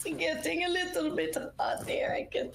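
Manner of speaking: teasing whisper. Real, not acted. Co-host jokes the show is getting steamy, in a teasing whisper. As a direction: breathe it out as a playful tease.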